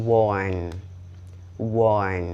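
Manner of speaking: "warn" with a rounded O vowel, WORN; correct pronunciation of warn. Shown as a demonstration. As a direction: say "warn" drawn out as wawrn. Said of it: "สระอ่อนเนาะ".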